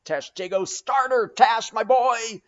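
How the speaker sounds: high, loud cheering on of the rowers; urging shout. A narrator's reading. Stubb spurring Tashtego and the crew, high ringing shouts. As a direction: drive the crew on at full voice.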